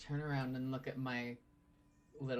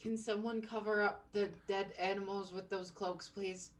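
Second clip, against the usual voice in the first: yelling from another room; 7 semitones higher than usual. Acted. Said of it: "I'm just gonna yell from the other room".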